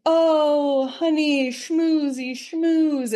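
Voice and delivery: ooze fake sweetness; schmoozing manipulator. Manipulative husband sweet-talking his wife, high and oily. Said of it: "manipulator might come in".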